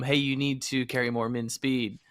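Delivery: usual speaking voice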